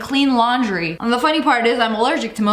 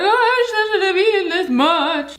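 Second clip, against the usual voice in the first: exaggerated, pitched-up lament; overfull regret; 5 semitones higher than usual. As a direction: whine it like you badly overate. Acting out regret after overeating.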